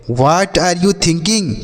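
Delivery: very slow, each word clear; slow model question. Shown as a demonstration. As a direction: model "What are you thinking?" word by word. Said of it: "तो आप उससे क्या पूछेंगे".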